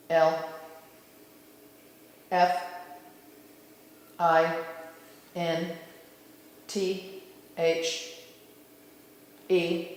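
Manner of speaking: slow, soft letter-by-letter spelling; low-key, measured dictation of single letters. Real, not acted. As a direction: call each letter singly with long pauses. Spells out guides' message letter by letter.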